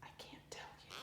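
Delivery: hushed secret; whisper. A narrator's reading. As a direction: breathe it out barely audible. Boy whispering his secret.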